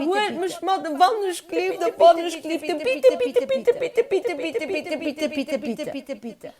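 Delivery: very high, loud pleading that fades away; frantic pleading trailing off to a murmur; begging, fading out. A narrator's reading. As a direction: beg over and over until it dies down. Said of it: "ze flewen an ze biedelen".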